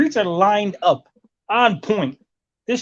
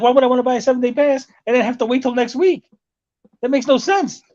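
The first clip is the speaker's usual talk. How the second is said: indignant; 7 semitones higher than usual. Retold or imitated. Arguing with bus driver.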